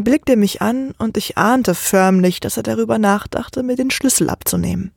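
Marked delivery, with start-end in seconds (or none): none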